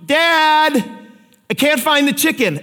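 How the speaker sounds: put-on child calling out. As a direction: call out helplessly from downstairs like a kid. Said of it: "you hear after five minutes".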